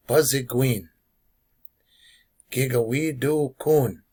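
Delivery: ordinary talk, nothing marked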